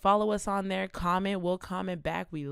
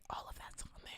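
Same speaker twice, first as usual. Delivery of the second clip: quick hushed aside; whisper; 15 dB quieter, faster than usual. Real, not acted. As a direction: drop to a whisper. Whispered aside about website.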